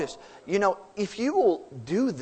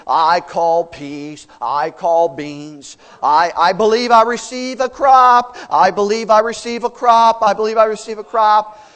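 mock believer shouting, building louder and higher; mock confession; 6 semitones higher, 12 dB louder than usual. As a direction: hammer the crop prayer harder each time. Mock frantic faith chanting.

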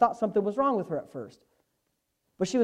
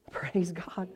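whispered praise; 6 dB quieter than usual. Real, not acted. Whispered praise after laugh.

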